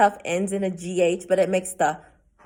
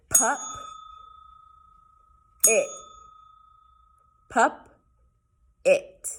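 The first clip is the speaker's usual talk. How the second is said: split into syllables; 3 semitones higher, much slower than usual. Shown as a demonstration. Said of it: "I'm gonna say it".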